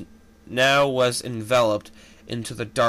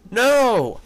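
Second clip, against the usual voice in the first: high, loud cry of alarm; 9 semitones higher, 6 dB louder than usual. Acted. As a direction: shout it as the building shakes. Star sprites cry out in alarm.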